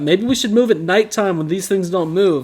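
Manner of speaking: mock bright idea. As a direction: voice it as a supposedly brilliant idea. Mocking an obvious idea as quote.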